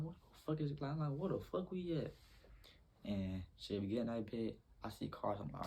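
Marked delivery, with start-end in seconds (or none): 0.0-2.1 high, baffled outburst; lost and thrown on a dark road; confused what the fuck. Retold or imitated. Lost on a long dark road, asking where they are in confusion.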